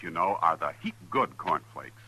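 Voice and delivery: ordinary delivery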